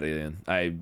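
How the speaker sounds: ordinary talk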